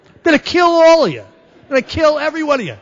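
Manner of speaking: loud, high, hammed-up drunken threat; threatening yell. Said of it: "I threaten all their lives".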